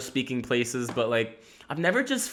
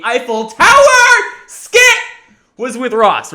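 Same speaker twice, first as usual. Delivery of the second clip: excited; 10 semitones higher, 12 dB louder, slower than usual. Real, not acted. Excited announcement of skit.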